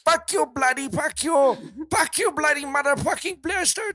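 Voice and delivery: hammed-up Indian accent, yelling insults at a driver; mock Indian accent. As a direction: shout it like an angry Indian man in an argument. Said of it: "the two Indian guys arguing".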